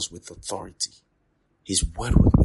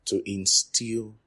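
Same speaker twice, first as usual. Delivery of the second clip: "To instill" drawn out slowly, then "doctrine"; slow teacher's dictation; slower than usual. Shown as a demonstration. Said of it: "to also instill doctrine".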